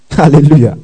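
a quick, joyful hallelujah through a laugh; real laughing praise of God. Real, not acted. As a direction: laugh it out in delight.

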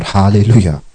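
normal talking voice

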